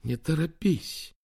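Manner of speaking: slow, high-pitched self-caution; persuading herself. A narrator's reading. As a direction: gently draw it out as a warning to yourself. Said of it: "уговаривала она себя". (медленно уговаривая себя)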